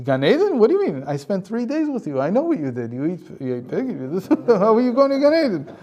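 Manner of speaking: high, loud mocking protest with a laugh; outraged disbelief. Satan protesting that the sinner gets paradise, high, loud and scoffing. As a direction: scoff at the idea he goes to paradise.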